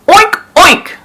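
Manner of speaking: high squealing pig oinks. Acted. Farmer feigning madness oinking.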